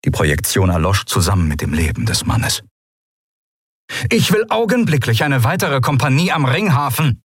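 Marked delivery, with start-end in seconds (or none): 4.1-7.2 calling out. A narrator's reading. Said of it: "rief Monaro".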